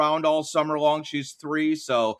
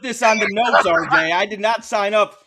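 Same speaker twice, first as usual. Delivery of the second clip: higher and louder playful objection; playfully indignant; mock protest; 6 semitones higher, 6 dB louder than usual. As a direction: pretend to be roped in against your will. Mock protest about Browns role.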